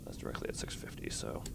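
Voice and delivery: hushed whisper reading the numbers; soft whispered aside. Real, not acted. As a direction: breathe it out under the mic. Quietly reading off depth.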